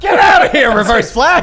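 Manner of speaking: shout. Pretend. Player yells at Reverse Flash to get out, loud and high. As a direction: blast him away with a yell.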